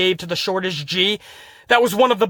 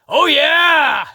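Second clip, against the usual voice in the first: loud, high, whooping shout; Otis; 7 semitones higher, 5 dB louder than usual. Retold or imitated. Wrestler answering his boss's dream assignment, gleeful mock shout. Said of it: "Otis's response to his boss telling him that".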